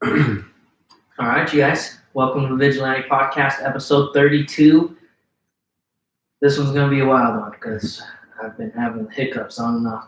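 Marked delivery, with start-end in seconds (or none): 0.0-7.5 upbeat show opening; podcast host intro. Acted. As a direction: announce the episode to listeners. Opens the podcast episode.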